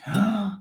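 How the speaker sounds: blowing out hard. Real, not acted. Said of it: "blow as hard as you can".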